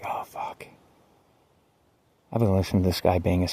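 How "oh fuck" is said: breathless whispered oh fuck; a dismayed whisper to yourself; whispered curse. Retold or imitated. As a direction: mutter it under your breath, unvoiced. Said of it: "I was like".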